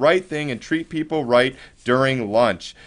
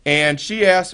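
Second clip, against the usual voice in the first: ordinary talk, nothing marked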